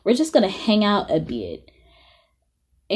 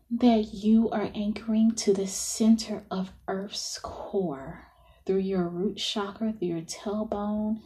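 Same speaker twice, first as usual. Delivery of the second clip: softer, half-whispered breathing cues; guided meditation; 7 dB quieter than usual. Acted.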